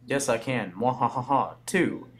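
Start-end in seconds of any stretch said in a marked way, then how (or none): 0.7-1.6 evil villain laugh. Acted.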